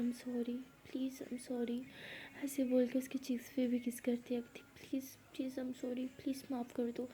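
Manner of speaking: soft, pleading whisper; whispering sorry close to her face; whispered apology. A narrator's reading. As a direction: beg forgiveness in a whisper.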